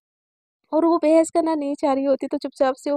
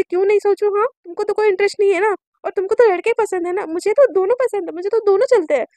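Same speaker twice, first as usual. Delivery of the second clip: higher, louder retort; heated defiance; 6 semitones higher, 6 dB louder than usual. Acted. Friend defends her crush to a rival, heatedly defiant. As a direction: push back hard in a quarrel.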